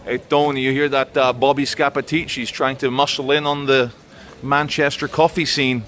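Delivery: a hint of a New York gangster; mock Italian-American mobster calling to Tony; mafia voice. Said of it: "he's got his capos".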